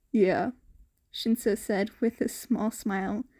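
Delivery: plain reading voice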